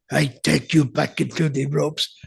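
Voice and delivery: put-on Russian accent, stilted delivery. Wrestler given a finish in the dressing room, fake Russian accent. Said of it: "they actually thought he was Russian".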